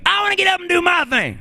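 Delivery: very high soul squeal; high funk-star holler. Retold or imitated. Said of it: "[name] was going".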